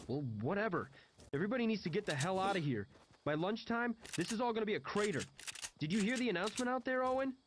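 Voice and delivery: high, fast, urgent character voice; frantic John, urgent. Acted. John warns everyone about the coming bombing, high and urgent. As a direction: rush it out high and insistent.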